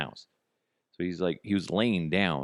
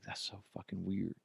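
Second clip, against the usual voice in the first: softer, uneasy under the breath; hushed; 11 dB quieter than usual. Retold or imitated. Father checking the cameras, hushed and uneasy. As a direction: say it quietly to yourself. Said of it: "I was like".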